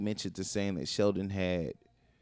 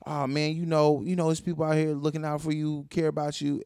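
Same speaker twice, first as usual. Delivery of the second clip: higher, breezy reassurance that brushes it off; imitating a pat-on-the-back comfort; mock-comforting, hurried cheer; compassionately dismissive; 6 semitones higher than usual. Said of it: "Compassionately dismissive".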